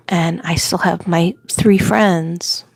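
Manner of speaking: ordinary talk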